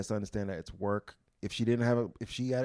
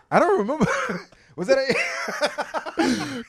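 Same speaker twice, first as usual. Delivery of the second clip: laughing; 8 dB louder, much slower than usual. Real, not acted.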